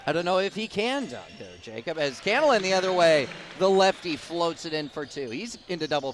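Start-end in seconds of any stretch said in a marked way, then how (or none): none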